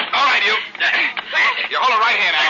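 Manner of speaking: tough shout. Acted. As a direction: bark it at the thief. Blackie grabbing the thief.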